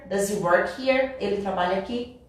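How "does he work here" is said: DOES HE WORK HERE slower, DOES HE as "duh-zee"; linked speech. Shown as a demonstration. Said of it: "aqui vai virar".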